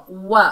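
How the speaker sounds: वा with the lips fully rounded; rounded waw. Shown as a demonstration. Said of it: "वा ऐसे करके पढ़ेंगे". (होंठ गोल करके वा)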